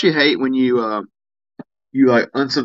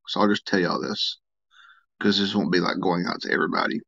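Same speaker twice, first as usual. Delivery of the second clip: quiet aside; 3 semitones lower, 6 dB quieter than usual. Real, not acted. Confiding to few stream viewers.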